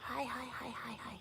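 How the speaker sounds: soft unvoiced hi, hi, hi; whispered hi. Real, not acted. Host whispers hellos to her dog on stream, whispered. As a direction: breathe the greetings almost silently.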